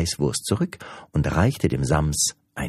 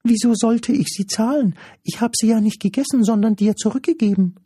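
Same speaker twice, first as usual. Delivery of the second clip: very high, loud, rapid creature protest; indignant impish creature, high and fast; protesting little creature; 6 dB louder, faster than usual. Acted. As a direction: rattle off the objection in a squeaky voice.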